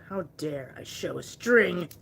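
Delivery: slow, mock-indignant; sarcastic mock outrage. Mocking the customer about a visible string, slow mock outrage. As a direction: drag it out as if the crime were huge.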